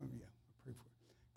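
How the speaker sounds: soft whispered aside to the woman. Real, not acted. Pastor whispering to the woman he called up, hushed. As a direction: drop to a hush, just for her. Aside while calling her forward.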